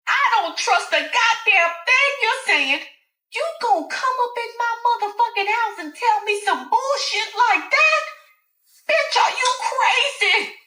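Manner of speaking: very high, loud incredulous yelling; screaming Alice. Acted. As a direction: she can't believe the nerve.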